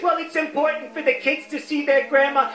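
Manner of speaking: high-pitched mock insistent relative; brother's wife. Brother's wife insists on seeing Grandma, high mock voice. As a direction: do the sister-in-law in a high voice. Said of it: "She says".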